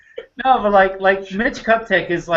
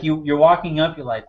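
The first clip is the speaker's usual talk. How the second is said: ordinary talk, nothing marked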